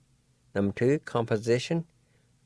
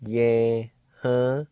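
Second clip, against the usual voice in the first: ordinary delivery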